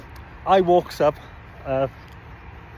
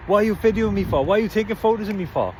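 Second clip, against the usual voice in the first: higher-pitched mock shouting over; retold shout; 6 semitones higher than usual. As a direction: imitate a man calling out an accusation. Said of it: "he was shouting over".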